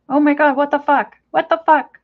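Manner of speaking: startled exclamation at the bird; shocked outburst. Real, not acted. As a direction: react to a mess you just found. Discovering poop on desk.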